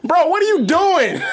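high, slow, laughing shout; exasperated. Real, not acted. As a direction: call it out high in amused disbelief. Exasperated at others during game.

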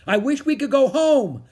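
high, loud shouted wish. A narrator's reading. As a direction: shout it out, pitched well up. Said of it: "shouted Annie".

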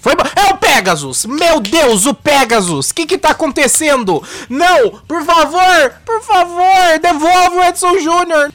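wild unhinged ranting. Pretend. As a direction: plead frantically for him back. Mock panic, host pleads for cohost.

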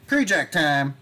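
hammed-up segment intro; announcer voice. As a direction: call the segment title like a host.

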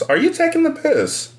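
high-pitched put-on British accent; mock British accent. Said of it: "hearing them say stuff that I wouldn't say, and it sounds great".

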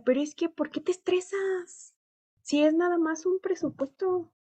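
high, dismissive mock of the boss; cynical boss. Imitating the boss shrugging off her stress, cynical. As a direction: brush off her stress like it's nothing. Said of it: "cínicamente".

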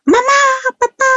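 put-on high, crying little girl calling for her parents; child crying out. Two-year-old daughter calls parents from bedroom, high crying yell. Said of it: "nos empieza a gritar".

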